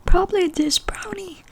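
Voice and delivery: high-pitched imitated answer; amused cashier. Cashier recommending a brownie, lightly mimicked amusement. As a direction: lift the voice high as the amused shop worker.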